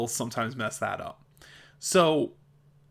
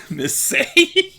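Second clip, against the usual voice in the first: laughing; 8 dB louder than usual. Real, not acted. Laughing at her own slip.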